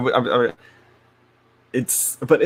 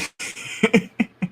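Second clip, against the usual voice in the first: breathy giggle; 10 semitones higher than usual. Real, not acted. Laughing at her own remark, airy giggle.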